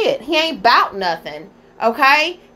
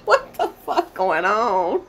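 laughing; 4 semitones lower, 3 dB quieter, slower than usual. Real, not acted. Laughing at the clip.

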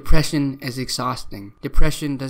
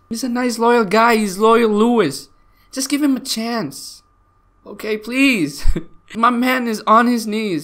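mock pleading; 8 semitones higher than usual. Pleading with ladies for Lewis.

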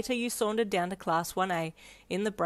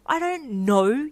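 slow, loud, higher angry retort; 6 semitones higher, 6 dB louder, slower than usual. Acted. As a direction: shoot it back angrily. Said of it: "you shot angrily at her".

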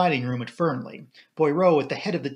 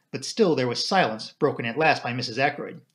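plain reading voice

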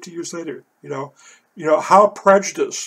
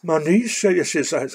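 normal talking voice

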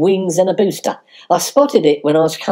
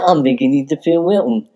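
ordinary delivery

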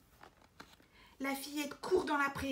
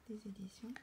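put-on soft whisper to a child; hushed prompt; 3 semitones lower, 12 dB quieter than usual. Shown as a demonstration. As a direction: whisper it for the little one to repeat. Adult whispers for child to repeat.

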